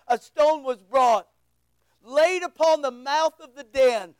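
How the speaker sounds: ordinary talk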